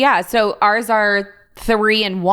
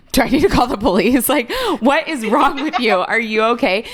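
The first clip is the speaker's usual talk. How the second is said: mock-panicked, higher, half laughing; alarmed husband; 4 semitones higher than usual. Husband reacting to his wife's timeout at bath time, mock alarm. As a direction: play the baffled husband, laughing as you go. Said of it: "my husband would be like".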